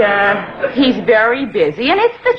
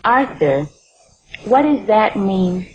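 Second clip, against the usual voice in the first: ordinary talk, nothing marked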